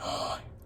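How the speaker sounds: soft gasp of surprise; hushed storytime gasp; mock gasp. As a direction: gasp as if the page holds a surprise. Turning the page to a surprise.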